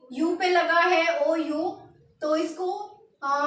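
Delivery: ordinary delivery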